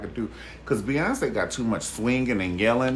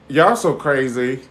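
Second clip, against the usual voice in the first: laughing; 5 semitones higher, 7 dB louder than usual. Real, not acted. Laughing at viewers' comments.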